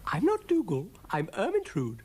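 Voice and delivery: high, loud self-important correction; high-pitched, proud lady character; rather proud. A narrator's reading. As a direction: announce yourself with pride. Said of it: "rather proudly".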